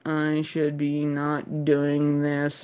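very slow, droning low assassin; plodding, mock-solemn inner monologue; reluctant assassin. Mocking reluctant assassin of Odyssey.

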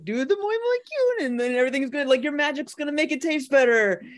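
high, sweet maid-café singsong; chirpy maid pitching the magic; cute maid voice. Retold or imitated. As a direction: coo it like an eager café maid. Voicing a maid café girl.

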